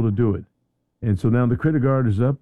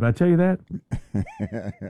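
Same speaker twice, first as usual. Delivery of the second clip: slow words through a laugh; chuckling all through; 2 semitones higher, 3 dB quieter, much slower than usual. Real, not acted. Laughing while saying it.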